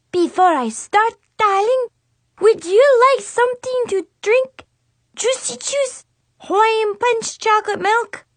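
high, loud fake French accent of a little girl; a kid hamming up a French salon hostess. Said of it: "awful fake French accent".

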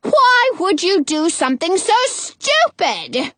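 slow, high scolding; high-pitched, drawn-out witch's rebuke; yelling. A narrator's reading. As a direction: scold her from below in outrage. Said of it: "Frau Gothel yells".

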